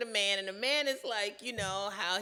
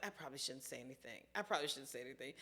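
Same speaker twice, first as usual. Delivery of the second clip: muttered very low and soft under the breath, fast; hushed inner thought; 11 semitones lower, 17 dB quieter, much faster than usual. Retold or imitated. Holding her tongue while the girl tells her dating story, hushed and low. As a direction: keep it to yourself, barely voiced.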